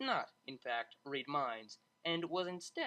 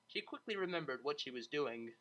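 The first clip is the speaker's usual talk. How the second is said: ordinary delivery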